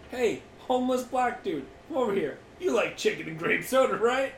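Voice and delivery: high, eager wheedling pitch; lightly voiced pushy buyer; mock sales pitch. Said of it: "imagine the barter process".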